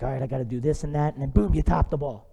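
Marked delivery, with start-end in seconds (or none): 0.0-1.1 mock anxious mutter. Golfer overthinking the swing.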